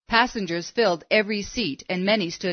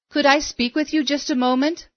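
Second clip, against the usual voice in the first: higher-pitched, mildly insistent request; lightly voiced woman pressing politely, pitched up; persistent; 7 semitones higher than usual. A narrator's reading. Woman pestering Billy on the bus, persistent. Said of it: "She persisted".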